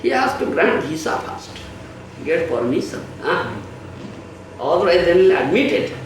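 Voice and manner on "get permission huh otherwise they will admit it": ordinary talk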